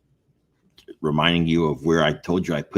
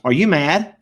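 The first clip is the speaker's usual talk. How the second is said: high, louder anxious wife asking; 9 semitones higher, 7 dB louder than usual. Retold or imitated. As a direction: mimic the worried wife, pitched up and pressing. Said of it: "she goes".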